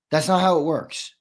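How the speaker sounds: ordinary talk, nothing marked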